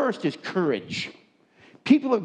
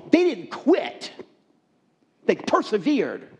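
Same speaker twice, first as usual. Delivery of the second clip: preaching that climbs higher and louder; building sermon; 7 semitones higher, 3 dB louder than usual. Acted. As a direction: push the point harder line by line, like a preacher. Rising preaching about perseverance.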